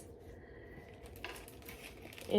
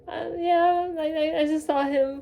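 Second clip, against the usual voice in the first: high, wavering reply at usual volume; imitating jittery nerves; 7 semitones higher than usual. Shaking fan answers mom's worried question, in mock jitters. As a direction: act out a nervous, stammered answer. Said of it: "shaking so bad".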